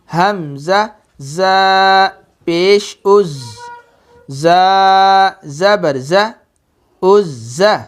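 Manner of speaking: slow, each letter held long with pauses; slow drawn-out spelling. Shown as a demonstration. Teacher slowly spelling out letters for students. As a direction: spell it out slowly, holding every sound. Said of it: "देखो मैं कैसे पढ़ता हूँ".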